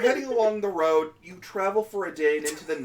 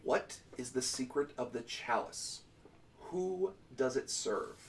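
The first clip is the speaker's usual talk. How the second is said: slow, solemn voice from the chalice; booming mystic voice; 5 semitones lower, 13 dB quieter, slower than usual. Acted. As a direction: intone it like an ancient oracle. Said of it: "And it says this".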